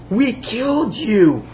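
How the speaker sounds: high, drawn-out imitation of shock; mock disbelief. President recognizing the man they killed, mock disbelief. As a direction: stretch it out high, hammed-up astonishment.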